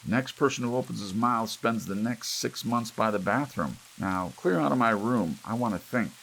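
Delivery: imperious order. A narrator's reading. Grandfather ordering the family out, loud and imperious. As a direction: lay down the law from his room. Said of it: "shouted Willie imperiously".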